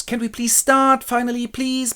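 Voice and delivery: high-pitched exasperated plea; upset director. Acted. Director trying to start rehearsal, upset. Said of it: "The director is all upset".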